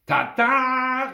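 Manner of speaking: high, loud ta-da; loud, stretched fanfare; triumphant call. A narrator's reading. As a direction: sing it out like a big reveal. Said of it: "rief die Frau kurz darauf".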